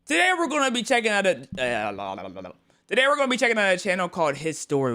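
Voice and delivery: high, loud, fast show-host intro; hyped intro announcer. Acted. Streamer opening the video for viewers, hyped announcer voice. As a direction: sell the episode like a TV host.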